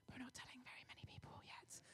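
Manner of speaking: secretive whisper; really quiet. Retold or imitated. Excited friend swears the speaker to secrecy, whispering. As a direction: whisper it under wraps. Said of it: "said to me really quietly".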